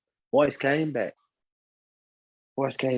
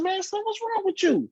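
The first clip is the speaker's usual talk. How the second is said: baffled other man. Retold or imitated. The man quoted, baffled reply.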